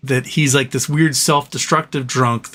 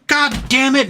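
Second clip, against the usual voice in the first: mock exasperated curse; 11 semitones higher, slower than usual. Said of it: "she just was like".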